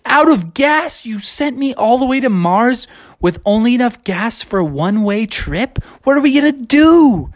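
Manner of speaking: high, alarmed cartoon voice; high voice, mock panic; panicky Bubby. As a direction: squeal it in disbelief.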